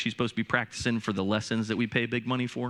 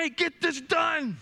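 mock yell; 14 semitones higher than usual. Dad retelling his order to the daughter, a hammed-up yell. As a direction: bark the order up the stairs.

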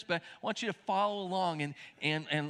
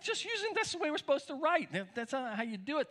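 much higher imitation of offended kids; mock offended; 9 semitones higher than usual. Kids objecting to dad's texting grammar, mock offended. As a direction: protest like an affronted kid, played lightly. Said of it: "sometimes they even get offended".

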